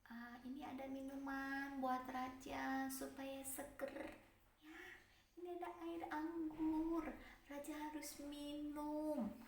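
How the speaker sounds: timid, soft cupbearer voice; soft and careful. Acted. Cupbearer gently addressing the angry king, soft and careful. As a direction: tiptoe around the angry king with your voice. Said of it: "ngomongnya pelan dan hati-hati sekali".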